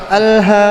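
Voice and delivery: "Alha" with a loud, airy "h" from the chest. Shown as a demonstration. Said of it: "itu bukan dari tenggorokan, tapi dari dada". ("Alha" dengan h dari dada, salah)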